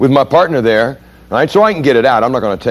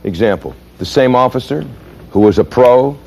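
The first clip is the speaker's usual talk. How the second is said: normal talking voice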